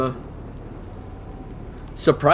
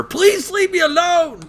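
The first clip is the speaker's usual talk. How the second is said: much higher, slow, whiny plea; mock squeaky plea; slower than usual. Cornered hero squeaking to the deadpan girl, high and whiny. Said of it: "And he goes".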